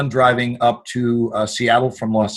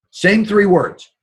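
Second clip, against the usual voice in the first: ordinary talk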